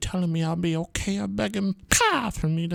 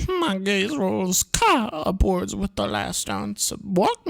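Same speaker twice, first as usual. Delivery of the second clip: usual speaking voice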